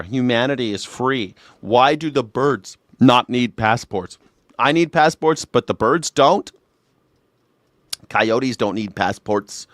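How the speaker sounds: ordinary delivery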